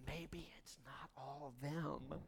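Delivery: whispered aside, low and slow. Real, not acted. Preacher whispering a hard truth to the church, whisper. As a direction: lean in and whisper it.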